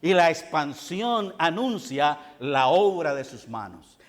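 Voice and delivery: ordinary talk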